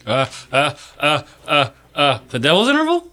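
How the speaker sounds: a caught-out student fumbling for the answer; flustered stammer. Acted. Student put on the spot.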